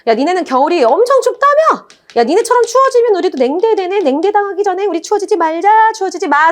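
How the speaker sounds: high, playful jeering; mock teasing. Temperate climate taunting cold climate about winter, mock teasing. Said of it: "말하면서 놀려".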